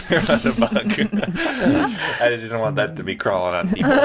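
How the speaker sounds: laughing through the words; giggling while talking. Real, not acted. Laughing about a bug.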